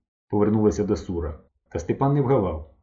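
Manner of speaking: plain reading voice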